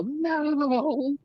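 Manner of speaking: long, high-pitched dramatic "no"; big theatrical high yell. Acted. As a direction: roar it out dramatically, drawn out and high. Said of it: "just yell".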